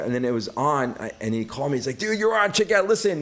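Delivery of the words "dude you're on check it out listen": high, eager rush of news; a friend bursting to tell you you're on TV; excited friend. Retold or imitated. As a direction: blurt it out like a thrilled buddy.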